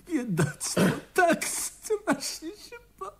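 mourning poor Betty through tears; tearful grief. Acted. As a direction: break into tears on it.

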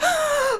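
high, sharp acted gasp; shocked gasp. After sister hangs up, shocked acted gasp. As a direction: suck in air in mock shock.

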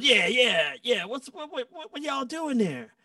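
high, loud mock bandleader grilling; imitating Greg. Greg grills the young players on the bus, mock grumpy.

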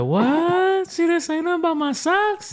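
high, surprised disbelief; mock surprise. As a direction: act amazed she didn't mind.

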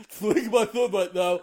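high goofy dog voice; squeaky, silly sword dog voice; Zacian impression. Still voicing the sword dog.

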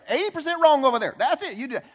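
high, fast, loud accusing mimic; mock complaining spouse. Pastor mimicking a blaming spouse, high and fast. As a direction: point the finger in a mock voice. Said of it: "point at your spouse and say".

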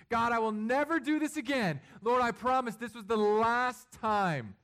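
high, loud pleading promise, slowing at the end; anguished penitent vow; desperate vow. Retold or imitated. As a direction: swear it to God, almost crying out. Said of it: "You're thinking".

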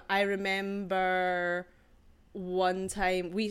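slow Scottish storyteller; slow, put-on Scottish accent. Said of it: "with my Scottish accent?".

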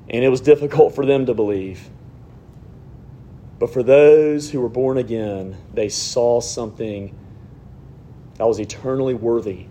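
low, measured prayer voice. Acted. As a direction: pray aloud, unhurried and earnest, pitched low. Leads the congregation in prayer.

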